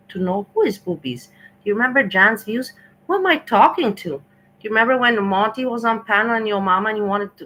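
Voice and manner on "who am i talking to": indignant call-out. Real, not acted. Heated stream argument, calling out.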